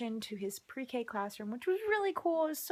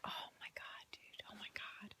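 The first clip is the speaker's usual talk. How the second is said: very soft, whispered groan; a worn-out mom hissing at the dogs; exasperated whisper; 19 dB quieter than usual. Retold or imitated. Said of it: "I'm like".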